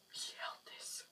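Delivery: barely audible, voiceless disbelief; stunned whisper. Retold or imitated. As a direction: whisper it as if too stunned to speak. Retelling stunned reaction to proof.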